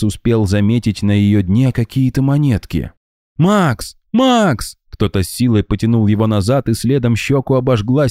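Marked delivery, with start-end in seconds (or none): 3.4-4.8 high sharp calls of his name; calling name. A narrator's reading. Friend calling Max back from the trance, high urgent. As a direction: snap him out of the trance.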